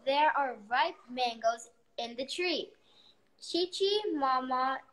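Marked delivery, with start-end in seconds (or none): none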